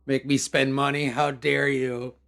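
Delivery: slow, loud hammed-up indignation at the trolls; playfully scolding the trolls who made her spend money, in mock outrage. Streamer scolds trolls after fixing lag, with hammed-up indignation.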